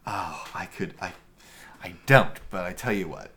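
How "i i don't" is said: usual speaking voice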